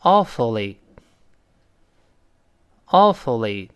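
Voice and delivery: awfully said slowly and clearly; deliberate, stretched "awfully"; read-aloud model. Shown as a demonstration. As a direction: model "awfully" for learners to echo. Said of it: "跟老师先读一遍".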